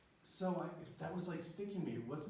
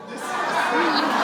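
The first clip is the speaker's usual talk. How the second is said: choked laugh; 13 semitones higher, 20 dB louder than usual. Real, not acted. Overcome on stage.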